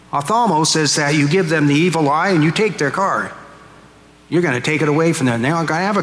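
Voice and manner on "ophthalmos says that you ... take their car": ordinary delivery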